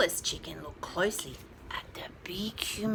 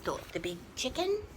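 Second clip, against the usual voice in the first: ordinary delivery, nothing marked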